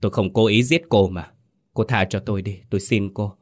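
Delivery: plain reading voice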